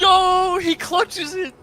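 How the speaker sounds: high, loud hype yell; excited shout. Real, not acted. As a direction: scream it in disbelief. Co-caster reacts to goal.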